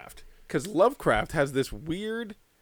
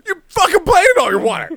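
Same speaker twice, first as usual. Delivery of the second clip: high, loud exasperated shout at the cat; hammed-up owner scolding pet; mock outraged yell; 13 semitones higher, 9 dB louder than usual.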